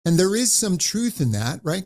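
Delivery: usual speaking voice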